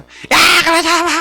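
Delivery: high-pitched mock scolding yell; imitated parental yelling. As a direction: imitate a mother starting to yell at her kids. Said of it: "소리 지르기 시작하는 거야". (아이들에게 소리 지르는 흉내)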